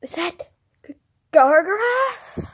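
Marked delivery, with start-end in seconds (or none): 1.3-2.5 scared question. Pretend. Meeting the monster.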